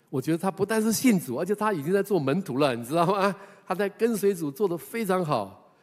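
high, loud marvelling to the church; excited preaching; amazed. Real, not acted. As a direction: share the wonder with the congregation. Preacher marvels at her discipleship.

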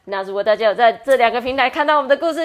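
high, loud, rushed outro in one breath; podcast sign-off. Acted. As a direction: announce it all without breathing. Closing promo in one breath.

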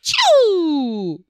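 a bully suddenly panicking and backing off; startled monkey. Acted. Monkey stammering in fright at the beetle. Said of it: "突然吓了一跳".

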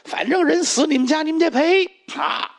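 high flustered woman insisting on payment; flustered shrill demand. Acted. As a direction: caught out, bluster your way through. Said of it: "薛凤霞傻了".

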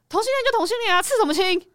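high-pitched, dismissive scolding; comic retold maternal outburst; exasperated mother. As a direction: imitate a mother fussing over the wrong thing. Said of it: "我妈就说".

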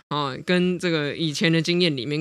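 normal talking voice

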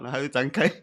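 normal talking voice

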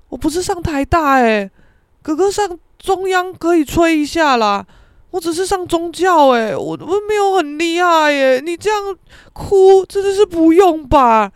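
high, loud, pleading objection; exasperated protest. Retold or imitated. Son to crying father, exasperated protest. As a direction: protest that the fuss is unwarranted.

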